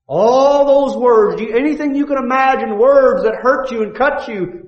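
high, loud, fervent preacher's cry; full-force preaching. Acted. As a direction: preach it out at the top of your voice.

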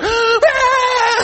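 put-on very high, shocked little-girl gasp; mock toddler shock. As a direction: squeak in outrage like a toddler. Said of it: "she's like".

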